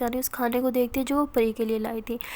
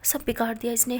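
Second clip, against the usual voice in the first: ordinary delivery, nothing marked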